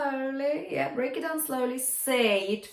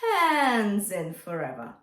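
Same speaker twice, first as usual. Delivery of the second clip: slow spoken phrase, breathy H into full voice; spoken flip exercise; spoken slowly. Shown as a demonstration. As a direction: breathe out, then bring the folds together on hands. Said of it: "let's do that together".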